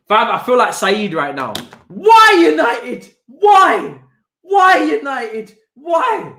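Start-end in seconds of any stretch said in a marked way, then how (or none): 1.9-6.3 loud, drawn-out despairing cry; slow, high, loud cry of despair; anguished shout. Real, not acted. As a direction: wail it at the screen, very slow. Despairing at the injury.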